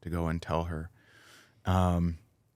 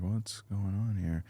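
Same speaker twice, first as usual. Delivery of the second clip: slow, softer mild bewilderment; puzzled wondering; 5 dB quieter, slower than usual. Retold or imitated. Tour member noticing everyone staring at phones, puzzled. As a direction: ask it slowly and quietly, puzzled.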